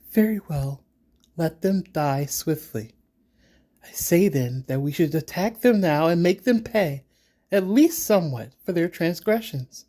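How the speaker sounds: high, hard-edged warrior woman; grudging, vengeful priestess; fierce priestess. Acted. GM voices fierce Siba.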